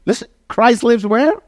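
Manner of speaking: climbing pulpit fervor; full-force preaching. Acted. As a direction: lift the room, preach it out.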